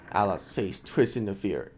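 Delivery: ordinary talk, nothing marked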